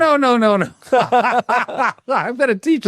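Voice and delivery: giggling, high-pitched no no no; laughing high voice. Real, not acted. Laughing, mock-teaching the host.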